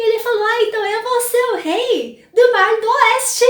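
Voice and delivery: a little dragon who can't wait to be king; eager excitement. Retold or imitated. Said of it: "ficou todo empolgado". (empolgado e animado, mais agudo e forte)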